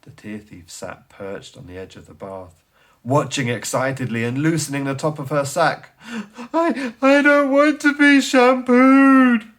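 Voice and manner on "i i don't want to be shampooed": high, loud, slow wail; wailing child. Acted. The boy in the bath wailing at his mother. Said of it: "wailed the boy in the bubbles".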